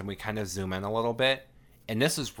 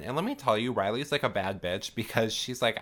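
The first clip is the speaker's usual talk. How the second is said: usual speaking voice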